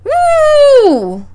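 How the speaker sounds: high, loud whoop of delight; real excited woo. Real, not acted. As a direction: cheer it out, thrilled. Hype reaction to big attack.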